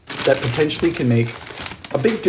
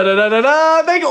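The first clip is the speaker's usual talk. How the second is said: normal talking voice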